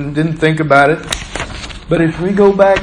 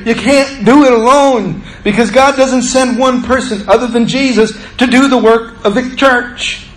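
normal talking voice

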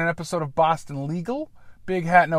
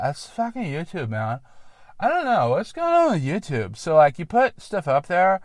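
usual speaking voice

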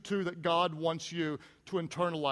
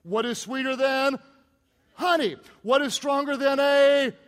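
loud, high gloating answer; triumphant answer; 4 semitones higher, 6 dB louder than usual. Retold or imitated. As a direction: crow the answer at Samson. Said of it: "here's the answer".

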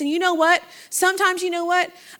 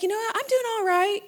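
soft, high, noncommittal reply; mildly put-on "I'm okay" voice; guarded reassurance; 5 semitones higher, 4 dB quieter than usual. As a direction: play it down lightly, keeping it small. Acting out a guarded small reply.

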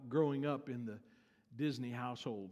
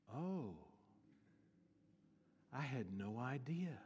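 soft, low acted astonishment; hushed realisation; 4 semitones lower, 12 dB quieter than usual. Little Diane learns who her dad is, hushed. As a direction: let it sink in, very quietly. Child's realisation voiced in story.

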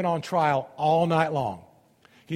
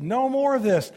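firm, raised command to stop; insistent; 8 semitones higher, 5 dB louder than usual. Acted. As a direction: insist on it, higher and louder. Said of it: "But Jesus insisted".

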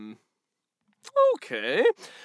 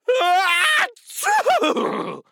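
high shouted donkey laugh; loud goofy laugh; 5 dB louder than usual. Acted. Donkey laughing at his own tumble, loud and high. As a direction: bray out a silly laugh.

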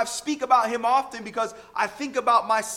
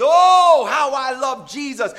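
joyful shout; 10 dB louder than usual. Shown as a demonstration. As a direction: shout it out in praise. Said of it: "we declare it with emphasis".

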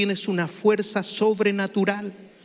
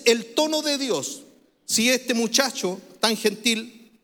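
normal talking voice

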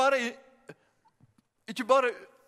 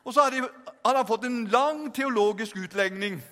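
ordinary talk, nothing marked

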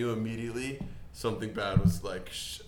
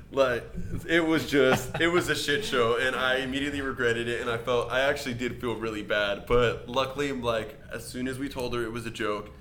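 normal talking voice

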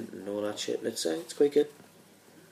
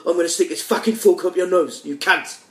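louder, higher, fast mock chef tirade; Gordon Ramsay rant; 6 semitones higher, 5 dB louder than usual. Mocking the TV chef for friends, fast angry shout. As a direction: tear into the cook at speed. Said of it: "I like Gordon Ramsay".

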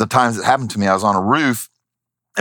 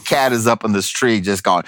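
ordinary delivery, nothing marked

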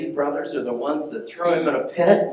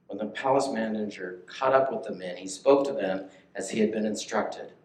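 ordinary talk

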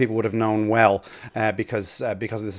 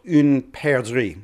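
"une perdrix" said in a self-mocking French accent, at a measured pace; spoken French "une perdrix" with a rolled r, "une" as one syllable; French pronunciation; much slower than usual. Shown as a demonstration. Bird expert tells the radio host the French name, in a French accent. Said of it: "Forgive my terrible French accent there".

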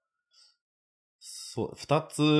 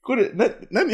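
confused; 11 semitones higher, 4 dB louder, slower than usual. Retold or imitated. Retold confusion.

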